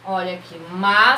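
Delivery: usual speaking voice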